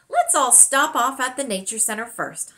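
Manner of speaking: a touch of an eager grandmother calling out; lightly voiced Honey, upbeat and inviting; exclaiming grandmother. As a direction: grandmother keen to gather everyone, mild exclaim. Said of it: "Honey exclaimed".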